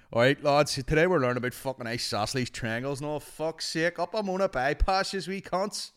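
rally the class like a rowdy lad; mock hard-man teacher, loud; rough-lad teacher. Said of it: "what the fuck could he possibly be teaching".

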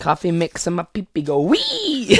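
silly giggly. Real, not acted. Vlogger joking about coffee, giggling.

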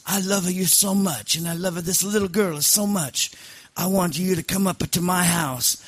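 put-on effusive, high-pitched Sicilian accent; Sicilian mob boss. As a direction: gush like a hammed-up Sicilian godfather. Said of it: "Sicilian mob boss once".

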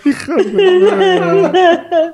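hammed-up weepy wail; mock sobbing. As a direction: whimper it like a crybaby. Mocking the crying friend.

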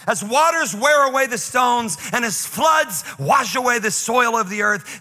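slow, ringing lament hurled up at God; dramatic scripture recital. Acted. Preacher reads Job's complaint to God, dramatic declamation.

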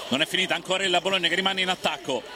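usual speaking voice

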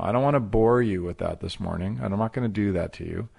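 normal talking voice

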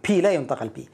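ordinary talk, nothing marked

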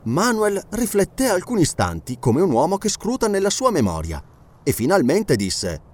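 usual speaking voice

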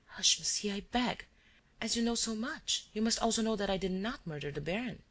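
a touch of tearful pleading; murmured entreaty. A narrator's reading. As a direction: beg him softly, close to breaking down. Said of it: "she murmured, breaking down and reduced to entreaties".

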